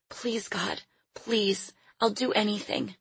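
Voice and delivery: put-on near-whispered child's plea; hushed pleading prayer. A narrator's reading. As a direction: beg God softly, breaking into a whisper. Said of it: "she prayed".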